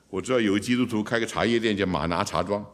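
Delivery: usual speaking voice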